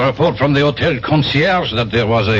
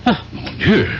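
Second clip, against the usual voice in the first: surprised recognition; 8 semitones higher than usual. Acted. Inspector recognizes the man.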